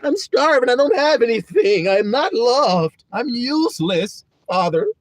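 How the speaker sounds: high, loud mock sob to God, slowing as it goes; hammed-up tearful plea ending in a cry; whiny begging. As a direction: beg like a pitiful wretch, half crying. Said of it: "Begging God".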